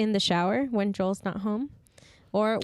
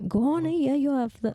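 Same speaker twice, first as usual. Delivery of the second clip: higher, lilting attempt at Irish accent; 6 semitones higher than usual. Friend attempting Irish on request, Irish accent. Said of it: "how would you do an Irish one?".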